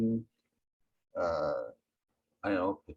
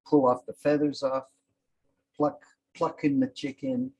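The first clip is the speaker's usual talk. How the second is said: ordinary delivery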